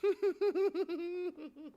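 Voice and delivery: high, wheezing laughter; helpless giggling; real laughing fit. Real, not acted. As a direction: laugh until the breath runs out. Cracking up at own joke.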